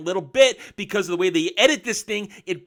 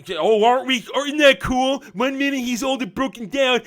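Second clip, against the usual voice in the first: higher, self-pleased sneer; smug mock voice; 6 semitones higher, 3 dB louder than usual. Reviewer mocking the show's writers, smug sneering voice. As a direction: mimic a smug writer patting himself on the back.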